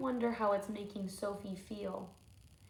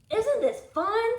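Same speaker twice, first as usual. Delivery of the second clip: put-on high, loud, delighted kid; gleeful; 10 semitones higher, 7 dB louder than usual. Teacher voicing Wendell asking Sophie, gleeful and loud. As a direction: bounce it out with glee.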